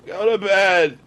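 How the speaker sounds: mock boozy dismissal; drunk mom. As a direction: brush the kid off like a half-drunk mother. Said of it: "she's half in the bag".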